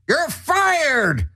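high, drawn-out mock shout; head chef firing. A narrator's reading. Head chef firing his assistant, mock outraged shout. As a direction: cry it out as a fed-up boss. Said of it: "He said the most incredible original words".

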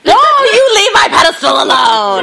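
high, loud mock-outraged shout; mock protest. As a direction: shout it in playful indignation.

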